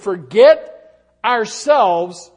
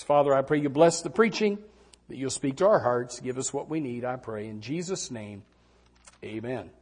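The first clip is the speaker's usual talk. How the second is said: low, hushed prayer fading softer; 8 semitones lower, 7 dB quieter than usual. Real, not acted. Preacher praying over the sermon, low and soft. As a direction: pray quietly and reverently, sinking to a soft amen.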